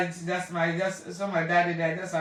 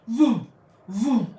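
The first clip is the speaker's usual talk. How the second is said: whoosh of visions; 6 dB louder than usual. Shown as a demonstration. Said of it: "see vision".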